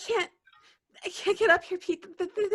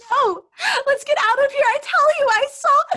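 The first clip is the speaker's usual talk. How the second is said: high, loud frightened pleading; terrified shouts to the boys to leave; panicked cry; 8 semitones higher, 10 dB louder than usual. Acted. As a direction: beg to get out, scared stiff. Panicked girl fleeing dark cellar.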